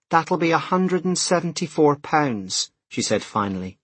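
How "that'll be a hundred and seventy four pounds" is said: slow, higher-pitched cashier voice; shop assistant. A narrator's reading. Cashier telling hurried customer the total, slow and high. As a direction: draw the total out like a checkout girl.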